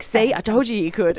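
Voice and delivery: playful I-told-you-so. Retold or imitated. Teasing mum that she could wear leopard print, playfully gloating. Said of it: "So I was like".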